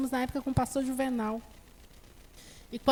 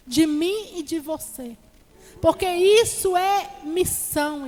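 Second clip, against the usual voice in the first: steady, earnest sermon voice; preaching; 6 dB louder than usual. Acted. Preacher exhorting the brethren about family and prayer, earnest sermon voice. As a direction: exhort the flock to make time.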